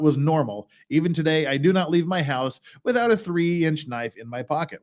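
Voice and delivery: ordinary talk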